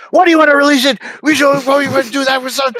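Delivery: high, fast squeaky whine; mock whiny protest. Mocking Danny's panicked protest, fast high whine. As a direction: squeal it out in a rush.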